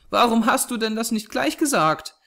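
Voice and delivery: put-on high, relieved child's voice; cheerfully exclaiming girl. A narrator's reading. Small girl relieved at the thought of dying, cheerful exclamation. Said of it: "rief die Kleine und lachte hell auf".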